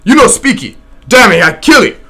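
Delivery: a savage's loud threat with the tomahawk; cannibal threatening. Acted. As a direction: threaten him in clipped pidgin. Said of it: "he at last said".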